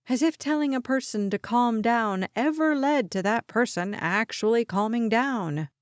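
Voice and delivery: usual speaking voice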